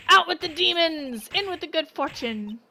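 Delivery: hammed-up old man, high and loud; cranky old man. Old man in pain throwing seeds, loud. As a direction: yelp and ward off demons.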